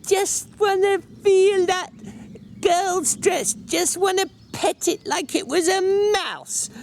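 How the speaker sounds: mock him like a little girl teasing. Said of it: "the elaborate manner of little girls when they are mimicking".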